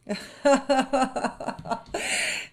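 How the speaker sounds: high mocking lamb snicker; sly little cackle; sneering snicker. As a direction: snigger at the cornered wolf. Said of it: "ricana l'agneau".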